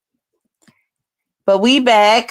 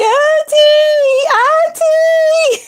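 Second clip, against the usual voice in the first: mock crying niece; 5 dB louder, much slower than usual. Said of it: "I know she gonna be".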